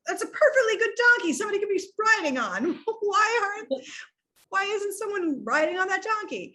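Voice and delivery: high, indignant onlooker voice; scandalized onlooker. Retold or imitated. Onlookers scolding that nobody rides the donkey, high indignant. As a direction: act the busybody outraged. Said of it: "they're like".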